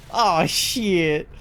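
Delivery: drawn-out Southern "shit", like "shee-it"; Southern accent. Acted. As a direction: drawl it in an exaggerated Southern accent. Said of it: "is one of 'em".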